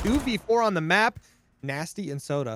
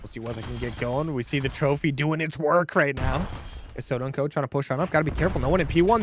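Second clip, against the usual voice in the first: normal talking voice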